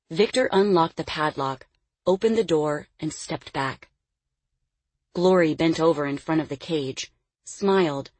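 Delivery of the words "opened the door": plain reading voice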